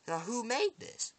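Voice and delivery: high, slow, drawn-out question; taunting demand. Retold or imitated. Villain asks who made the bullet, taunting demand. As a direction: stretch it upward like a teasing interrogator.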